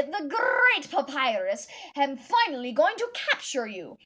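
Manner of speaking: grand, pompous skeleton hero; Papyrus voice. Acted. Cousin says her Papyrus is good, loud boastful Papyrus. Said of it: "now Papyrus".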